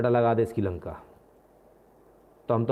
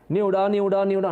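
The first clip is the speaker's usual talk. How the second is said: loud, higher calling out न्योडा न्योडा; bus conductor calling; 7 semitones higher, 4 dB louder than usual. Retold or imitated. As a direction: shout न्योडा like a Delhi bus tout. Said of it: "वो चिल्ला रहे होते हैं".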